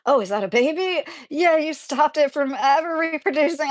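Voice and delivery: high, excited praise for the cat; gleeful cat praise. Retold or imitated. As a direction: cheer the cat on, delighted. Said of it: "I'm like".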